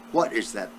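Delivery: usual speaking voice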